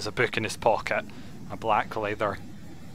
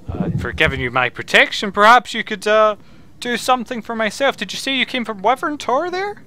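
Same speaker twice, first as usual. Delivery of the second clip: higher-pitched wizard, fishing for a favour; inquisitive wizard; 12 semitones higher, 6 dB louder than usual. Acted. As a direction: play a curious wizard, lifting into the question. Game master plays wizard.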